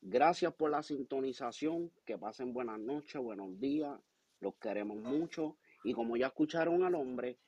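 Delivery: big show-closing announcer. Acted. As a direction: sign off the show like a ring announcer. Host signs off show in Spanish.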